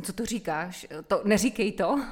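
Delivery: loud mock protest; shocked objecting women. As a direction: voice an appalled woman refusing to hear it. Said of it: "tak spousta žen úplně".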